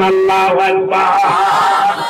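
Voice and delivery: long, drawn-out takbir cry; sustained loud congregational call; loud rallying shout. Shown as a demonstration. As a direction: lead the crowd in a slow, forceful shout. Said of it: "জোরে জোরে চিল্লায়া বলেন".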